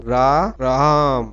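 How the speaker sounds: dragged-out vowel in रा, राम; pluta vowel demo. Shown as a demonstration. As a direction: stretch the vowel in राम out very long and slow. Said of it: "जिनके उच्चारण में तीन गुना समय लगता है".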